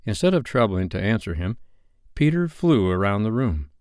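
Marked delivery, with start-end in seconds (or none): none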